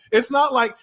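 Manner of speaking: normal talking voice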